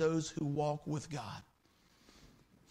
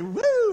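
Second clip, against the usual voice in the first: high loud playful "woo"; a startled whoop, hammed up; mock woo; 11 dB louder than usual. Listener inside his head whooping.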